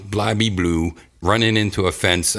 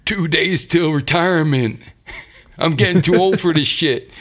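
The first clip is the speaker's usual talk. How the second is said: put-on weary old cop quote; 8 semitones higher than usual. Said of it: "It's like".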